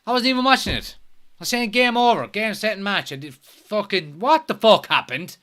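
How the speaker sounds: high, loud, baffled protest; real disbelief at a goal just missed; incredulous outburst. Real, not acted. Caught out by sudden goal.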